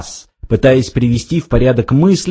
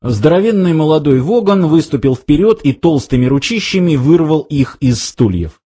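plain reading voice

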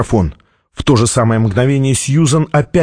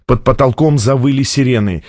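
usual speaking voice

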